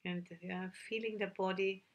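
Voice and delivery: calm meditation guide with long, unhurried pauses; measured, settled voice, leaving silence between instructions; guided meditation. Real, not acted. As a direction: gently lead sitters inward, phrase by phrase.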